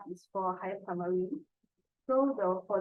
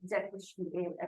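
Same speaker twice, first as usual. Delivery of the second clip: ordinary delivery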